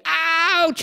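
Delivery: high-pitched mock yelp; retold shout of pain. Dad stung by a bee cries out, retold shout. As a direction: imitate a startled, high "ouch". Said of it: "the way he shouted so loud".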